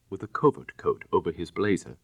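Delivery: ordinary delivery, nothing marked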